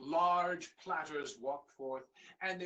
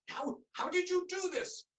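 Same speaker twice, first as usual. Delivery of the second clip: much higher, stammering disbelief; astonished king; 13 semitones higher, 4 dB louder than usual. Acted. King cannot believe the sorted rice, astonished. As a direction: gasp it out in disbelief.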